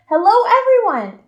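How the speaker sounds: with excitement. Shown as a demonstration. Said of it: "I made sure to say it with excitement".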